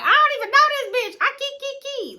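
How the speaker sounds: high squeaky imitation of her laughing; mock giggle. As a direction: cackle like the careless friend. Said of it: "And she thinking like".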